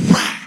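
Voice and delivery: sudden loud "wah" of a swing; imitated swinging noise. Brother swinging a spatula in a fight, loud imitated swipe. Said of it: "all of a sudden, I go".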